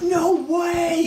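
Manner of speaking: high, loud disbelieving yell; excited shout. Retold or imitated. Fan freaking out at meeting the surfer, loud high shout. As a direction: shriek it like a starstruck fan.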